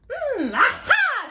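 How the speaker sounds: high, loud aha with a laugh; excited shout. Acted. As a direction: leap at the idea. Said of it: "she shouted with a jump".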